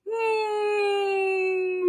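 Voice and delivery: long, high sustained hum; hum placed back. Shown as a demonstration. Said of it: "bring it back".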